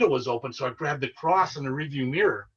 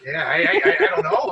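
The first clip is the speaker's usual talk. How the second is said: talking through a real laugh, pitched much higher and louder; nervous laughter; 6 dB louder than usual. Real, not acted. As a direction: laugh it out, shaken and high. Nervous laugh after scary story.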